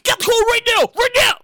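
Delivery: high, urgent barked commands; shouted police orders. Acted. Police ordering people out of a car, high urgent commands. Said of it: "gotta have the gun pointed".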